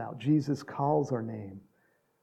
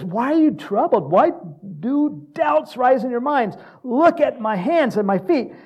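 loud, high, insistent questioning; emphatic appeal; 9 semitones higher, 8 dB louder than usual. Acted. Preacher as Jesus challenging the troubled disciples, loud and emphatic. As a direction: press them to look and believe.

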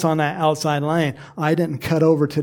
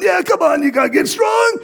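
high, loud mock coach yelling; a coach hollering at a lazy runner; coach shout; 13 semitones higher, 5 dB louder than usual. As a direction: bark it like a coach on the track. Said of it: "the coach is going".

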